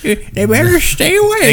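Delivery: put-on high, slow old granny warning; grandma voice. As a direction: croak it like a stern old grandma. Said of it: "in the most grandma voice".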